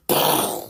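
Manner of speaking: explosive mouth noise imitating a sudden sound; mimicked boom; imitated loud boom. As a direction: burst out a noisy blast with the mouth. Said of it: "a Boom Boom Naroo go".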